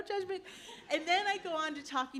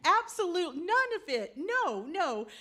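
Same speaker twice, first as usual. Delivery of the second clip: emphatic louder no; firm denial; 5 semitones higher, 5 dB louder than usual. Retold or imitated. Uncle flatly denying Danny is dad's son, louder. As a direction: shut the idea down hard. Uncle firmly denies rumor.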